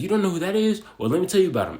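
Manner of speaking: high, eager know-it-all voice, jumping up at the start; mimicking the coworker. Coworker to the narrator, eager and high-pitched. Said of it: "And he's like".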